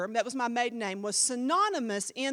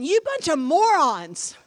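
slow, louder, scolding punch; exasperated shout; 3 semitones higher, 10 dB louder, slower than usual. Retold or imitated. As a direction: hammer each word at the fools. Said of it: "That's an Andrea version".

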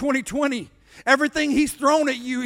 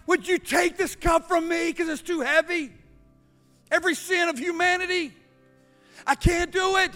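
softened, slightly raised pleading voice; anguished plea; 3 semitones higher, 5 dB quieter, faster than usual. Acted. Jesus begging the Father to take the cup, softly pleading. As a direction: plead gently under a heavy burden.

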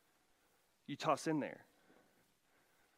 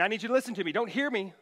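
put-on raised, insistent voice to the kids; firm parent command; 5 semitones higher, 5 dB louder, faster than usual. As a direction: demand the kids' attention. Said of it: "and you're saying".

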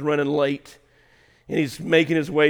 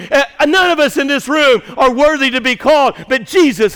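loud, shouted, higher sermon climax; preaching at full force; 9 semitones higher, 12 dB louder than usual. Acted. Pastor rousing the congregation, shouted preaching. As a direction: shout the names out over the room.

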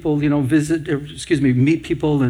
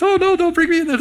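high mock wail of protest; imitating screaming patients; faster than usual. Resident begging not to be wheeled in, high mock wail. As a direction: whine it way up high, panicking. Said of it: "they're screaming".

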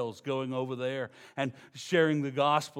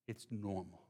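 "It's normal" dropped much softer; quiet, settled "It's normal"; soft restatement; 7 semitones lower, 14 dB quieter than usual. Shown as a demonstration. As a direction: let "It's normal" fall away gently. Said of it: "repeated for emphasis".

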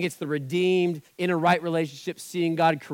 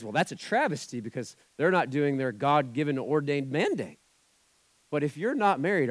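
ordinary talk

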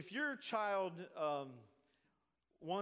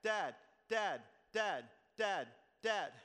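put-on loud, insistent child calling Dad; pestering child; 3 semitones higher, 8 dB louder, slower than usual. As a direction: tug at him, calling over and over. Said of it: "they're pulling on your pant leg".

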